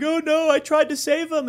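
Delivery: high-pitched mock wailing; badly faked anguish for the rescuers; hammed-up would-be rescuer; feigned distress. Acts killer feigning distress to rescuers.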